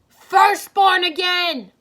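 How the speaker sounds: loud, high, slow cry of alarm; alarmed shout. A narrator's reading. Arcade shouts a warning to the hunters, loud alarmed cry. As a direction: yell the warning as the dragon appears. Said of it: "Arcade shouted".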